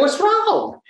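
very high, innocent Southern question; Southern accent. Retold or imitated. Naive Southern reply to company.

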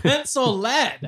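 slow, loud, high incredulous question; shocked disbelief. Real, not acted. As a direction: stretch it out, appalled. Shocked repeat of friend's confession.

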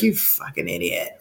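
very low, hushed aside; muttered insult. Retold or imitated. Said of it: "where I'm just like".

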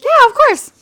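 high, loud, rushed-out agreement; exaggerated high-pitched compliance; over-eager yes. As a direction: squeal a too-willing yes. Retold eager yes to manager request.

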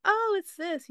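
high, loud fake delight. Forcing delight at a gift she doesn't understand. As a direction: pretend you love the gift.

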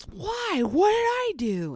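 put-on high, whiny kid protest; protesting child. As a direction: imitate a child objecting to a time-out. Child protests time-out, rolls eyes.

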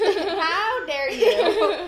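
playful, stretched out mock offense; mock outrage. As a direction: pretend to be scandalized. Mock outrage at four dislikes.

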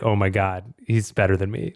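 ordinary delivery

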